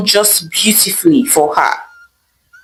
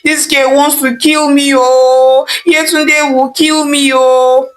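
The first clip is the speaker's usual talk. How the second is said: screaming and wailing; 5 semitones higher than usual. A narrator's reading. Mother learns her daughter has fled, hammed-up wailing. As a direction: wail it out like a distraught mother. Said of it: "screaming and wailing".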